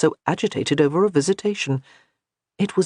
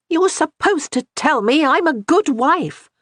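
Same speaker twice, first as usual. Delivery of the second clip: higher, louder frustrated outcry; cry out; 11 semitones higher, 5 dB louder than usual. A narrator's reading. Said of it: "she cried out".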